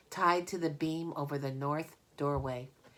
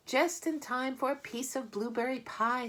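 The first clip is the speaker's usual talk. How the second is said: farm wife voice; 9 semitones higher than usual. Acted. Mrs Zuckerman greets the children.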